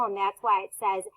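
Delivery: ordinary talk, nothing marked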